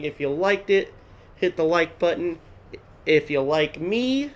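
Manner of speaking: usual speaking voice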